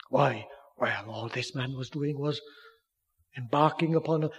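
ordinary talk

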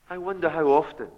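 ordinary talk

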